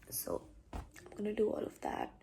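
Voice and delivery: soft morning whisper. Real, not acted. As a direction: whisper so nobody wakes. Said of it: "It's my morning voice. I can't be loud".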